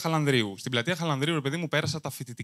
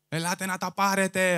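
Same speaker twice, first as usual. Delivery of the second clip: higher street-vendor cry, elate na ta parete sung out; Brekas street-seller call; 4 semitones higher than usual. Retold or imitated. Comic imitates famous square character, vendor's call. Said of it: "Το ξέρετε, τον Μπρέκα".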